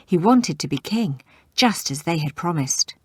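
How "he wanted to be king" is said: usual speaking voice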